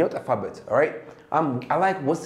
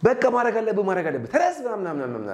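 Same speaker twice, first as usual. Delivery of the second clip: high, rapid-fire mock scolding; nagging father; 8 semitones higher, much faster than usual. Father ordering a child away from the TV, nagging fast. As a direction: rattle off orders like a fed-up parent.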